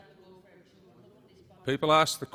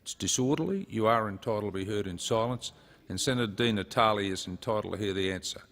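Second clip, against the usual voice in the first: ordinary delivery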